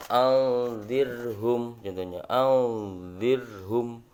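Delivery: anzirhum drawn out very slowly, thin ro; teacher recites the example to eighth graders; Quranic recitation. Shown as a demonstration. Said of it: "Seperti contoh".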